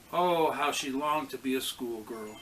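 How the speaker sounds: usual speaking voice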